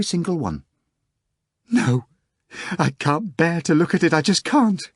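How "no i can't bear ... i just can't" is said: higher, distressed pleading; a hint of crying. A narrator's reading. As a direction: turn away, unable to bear it. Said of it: "Anna Richardovna cried".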